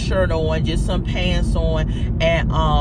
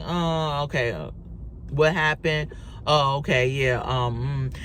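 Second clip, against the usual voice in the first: imitating an uncaring official, low and listless; mumbly, unemotional mock of a bored officer; monotone, lackluster; 3 semitones lower than usual. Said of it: "He was very monotone, very unemotional, very just lackluster".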